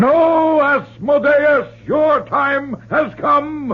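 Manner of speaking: exorcist's forceful challenge; stern command. Acted. Reverend confronts demon.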